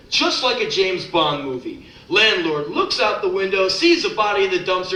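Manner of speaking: rhythmic, punchy spoken-word telling; poem performance. Acted. Poet telling a dark story poem to the crowd, punchy spoken word. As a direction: deliver it like a beat poet on stage.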